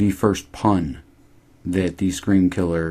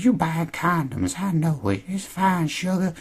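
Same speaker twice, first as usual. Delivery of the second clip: put-on high, teasing old woman voice; 11 semitones higher than usual. Cashier teasing [name] about condoms, high and flirty. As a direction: lean in and purr it at him.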